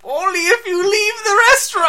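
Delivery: high, shouted put-on Chinese accent; loud, piercingly high Chinese-accent caricature; mock Chinese accent impression. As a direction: yell it in a hammed-up Chinese accent. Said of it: "you're gonna be the Chinese guy".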